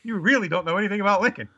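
high-pitched mock outrage; exasperated scolding. Retelling a scolding of the kid.

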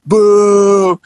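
long, high drawn-out boo; imitated crowd booing. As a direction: boo like an unhappy audience. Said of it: "I can hear everybody booing".

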